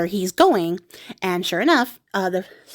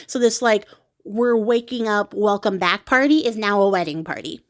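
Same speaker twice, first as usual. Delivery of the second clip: ordinary delivery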